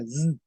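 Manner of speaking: single "z" buzzed and held; isolated buzzing "z"; voiced s sound. Shown as a demonstration. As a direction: make "z" a voiced English-style buzz. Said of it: "Cuando hay una S".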